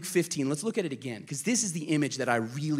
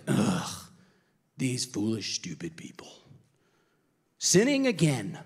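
disgusted God; 4 semitones lower than usual. Pretend. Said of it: "It's not that God is like".

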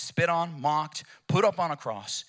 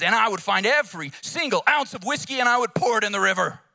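loud, high sung-out preaching climax; preacher's climax; 6 semitones higher, 6 dB louder than usual. Retold or imitated. Temperance preacher bringing the crowd to its feet, loud and sung. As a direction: hit the peak of the sermon, ringing out. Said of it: "And he says".

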